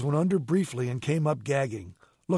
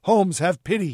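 higher, louder plea; pleading; 6 semitones higher, 6 dB louder than usual. A narrator's reading. Cornered Moran to Holmes, pleading. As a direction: beg for mercy urgently. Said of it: "then reached behind him".

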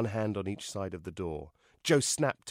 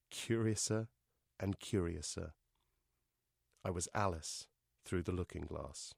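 softer, lower musing; hushed wonder; 4 semitones lower, 8 dB quieter than usual. A narrator's reading. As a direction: let it sink down quietly. Wondering close of the story.

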